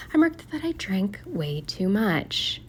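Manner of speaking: slow, soft near-whisper; hushed confession. Real, not acted. Vlogger admitting to Sunday's drinking, in a hushed voice. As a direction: confess it under your breath, drawn out. Confessing she drank too much.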